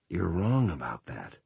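faint, drugged, barely getting words out; weak drugged voice. Acted. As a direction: push the words through heavy sedation. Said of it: "Roland tried to reply ... nothing came out".

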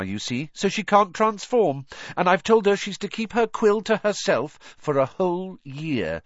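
put-on high, pleased girl character; Hermione. Hermione laying down Rita's terms, high and firm. Voices Hermione explaining her charm.

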